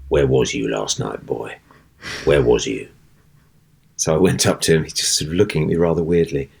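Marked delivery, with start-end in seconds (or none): 0.1-2.9 low, gruff, menacing stage voice; stage character line. Acted. Actor's opening line to Mister Sloane onstage, low menacing thug voice. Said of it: "my opening line to him was".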